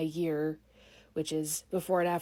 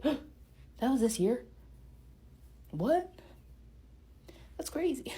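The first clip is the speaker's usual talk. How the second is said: surprised; 5 semitones higher, 5 dB quieter, slower than usual. Retold or imitated. Amazed at her own year.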